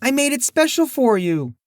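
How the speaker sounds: high nervous waitress voice; timid, faltering reply; stammering waitress. Acted. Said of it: "Maggie stammered".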